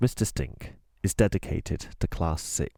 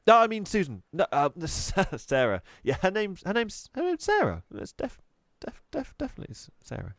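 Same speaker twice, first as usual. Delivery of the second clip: high, panicky stumbling over a made-up name; flustered stammer; 10 semitones higher than usual. Acted. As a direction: trip over every syllable, caught in a lie. Chloe caught out inventing a name.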